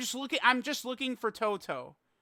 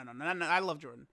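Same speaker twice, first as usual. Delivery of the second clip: ordinary delivery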